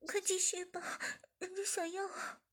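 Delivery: high, panting plea; breathless. A narrator's reading. Zhiming pleads to the boss, out of breath. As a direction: gasp the words out. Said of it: "志敏喘着气说".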